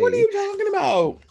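high, incredulous retort; mock disbelief at the husband. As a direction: scoff at the absurd idea.

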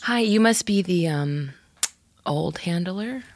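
normal talking voice

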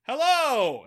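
mock holler across the water; retold yell. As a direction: call out as if to someone far away. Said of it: "he yells at a ship".

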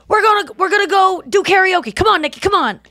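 mock-urging Nikki to karaoke; hyped-up friend coaxing her out; imitating Donnell. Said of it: "he's like".

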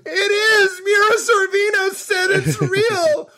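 mock terrified crying plea; panicked cry. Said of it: "I'm sitting there going".

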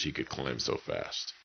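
ordinary delivery, nothing marked

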